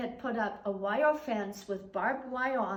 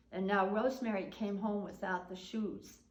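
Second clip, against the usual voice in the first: usual speaking voice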